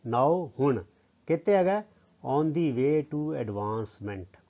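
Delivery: ordinary delivery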